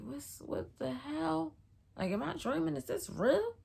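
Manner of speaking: high, bewildered questioning; dazed disbelief. Retold or imitated. Disbelief on waking, retold.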